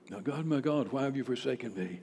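low, soft retelling of a cry; subdued recap. Retold or imitated.

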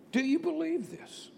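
soft, high-pitched, searching appeal; earnest personal question. Acted. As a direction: put the question gently and intimately to each listener. Jesus asks each listener personally.

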